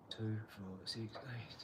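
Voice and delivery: muttered count under the breath; quiet counting aside; counting to himself. Real, not acted. Counting bricks under his breath.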